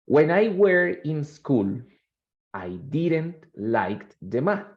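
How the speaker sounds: slow, deliberate read-aloud; reading slowly. Shown as a demonstration. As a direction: read each phrase out slowly for the class. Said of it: "I'm going to read slowly".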